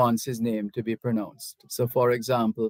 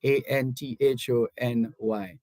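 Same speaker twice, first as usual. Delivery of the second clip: ordinary talk, nothing marked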